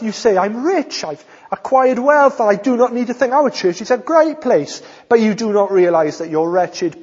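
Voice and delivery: emphatic, high-energy pulpit reading; forceful preaching. Acted. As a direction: declaim the rebuke to the congregation. Preaches scripture at full force.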